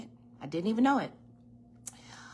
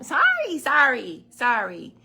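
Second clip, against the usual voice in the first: loud, laughing apology to someone nearby; loud fading apology, laughing; loud sorry; 5 semitones higher, 11 dB louder than usual. Real, not acted. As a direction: call out a quick laughing sorry.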